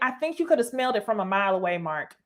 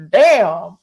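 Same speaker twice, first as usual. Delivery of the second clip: emphatic damn; 5 semitones lower, 9 dB louder than usual. Retold or imitated. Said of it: "I was like".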